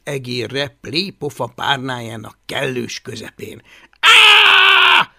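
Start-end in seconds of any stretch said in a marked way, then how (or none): 4.0-5.1 long, high, loud scream; howling scream. A narrator's reading. Boy howls at the mess on the pillow, screaming. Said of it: "üvöltötte Hablaty".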